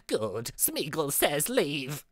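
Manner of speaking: low, slow, soft creature voice; badly faked cave creature, drawn out and quiet; Smeagol. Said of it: "to witness my Smeagol voice".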